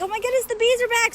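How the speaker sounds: high, loud, quick excited cry; imitating her daughter's thrilled shout; mock kid announcing the bees returned. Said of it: "she's like".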